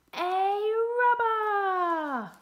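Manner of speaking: very drawn-out, loud, high announcement; grand storyteller's proclamation; dramatic reveal. A narrator's reading. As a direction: stretch it into a huge reveal. Big reveal in picture book.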